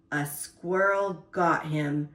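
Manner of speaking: usual speaking voice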